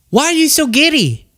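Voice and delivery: high-pitched incredulous question; exasperated. Real, not acted. Exasperated question to absent Candace.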